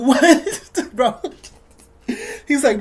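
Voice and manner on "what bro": laughing disbelief. Retold or imitated.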